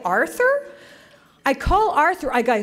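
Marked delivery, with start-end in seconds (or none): 0.0-0.7 puzzled. Retold or imitated.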